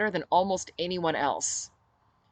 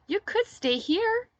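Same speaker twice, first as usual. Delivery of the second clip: put-on much higher, slow little girl's plea; pleading child; 4 dB louder, slower than usual. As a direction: coax him to stay like a toddler.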